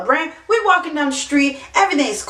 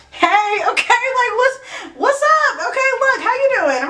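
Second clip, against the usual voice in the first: flustered giggly hello; 9 semitones higher than usual. Retold or imitated. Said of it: "And I'm like".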